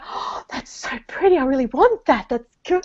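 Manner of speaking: high, hushed, gushing over a pretty gift; hushed delight. Retold or imitated. Opening someone else's parcel, coveting the gift: hushed delight. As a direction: ooh over it under your breath. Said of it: "and I'm like".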